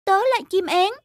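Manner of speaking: usual speaking voice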